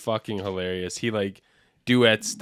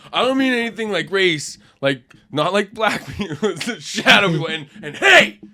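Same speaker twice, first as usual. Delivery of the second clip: mock rant building to a high, loud yell; imitating sudden mood switch; 6 semitones higher, 6 dB louder than usual. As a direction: start near normal, then switch into a hammed-up shout. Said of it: "Just suddenly goes bipolar".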